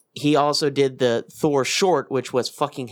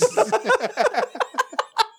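high-pitched laugh. Real, not acted.

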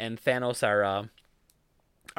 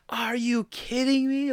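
high, slow disbelieving cry; incredulous theater woman; 10 semitones higher, slower than usual. Retold or imitated. Woman in the theater reacting to Thanos, stunned disbelief. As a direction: imitate a shocked moviegoer, much higher and drawn out. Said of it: "one woman in the theater just going".

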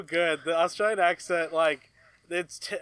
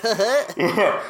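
fake Australian accent; 3 semitones higher, 5 dB louder than usual. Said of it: "I love fake Australian accents".

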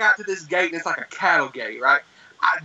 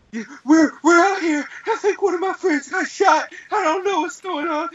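high-pitched acted panic; freaking out; 11 semitones higher than usual. Caller telling nine one one about a shooting, mock panic. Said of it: "I'm freaking out".

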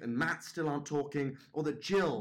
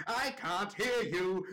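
slow, high, drawn-out taunt; childish taunt; 7 semitones higher, slower than usual. Retold or imitated. Dad with fingers in his ears, childishly taunting the judge. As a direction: stretch it out to block the other person out. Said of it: "dancing in a circle with his fingers in his ears".

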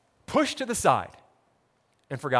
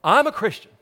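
indignant claim; 4 semitones higher, 7 dB louder than usual. Acted. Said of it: "they start thinking to themselves".

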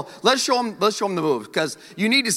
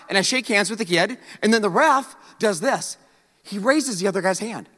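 ordinary talk, nothing marked

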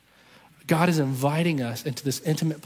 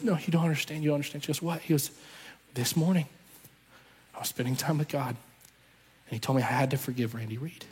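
hushed, broken confession; 2 semitones lower, 6 dB quieter than usual. Retold or imitated. Husband on his knees confessing to his wife, hushed and broken. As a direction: let it come out quietly, like a man who has just fallen to his knees.

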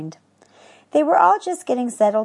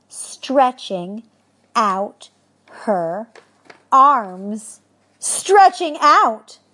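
drawn out; 4 semitones higher, 6 dB louder, much slower than usual. Shown as a demonstration. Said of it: "reader stretches the word".

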